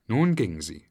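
ordinary delivery, nothing marked

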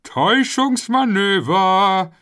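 high, loud, slow triumphant call; shouting. Acted. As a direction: call it back over the water, triumphant. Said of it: "Schnuffel rief ihm noch hinterher".

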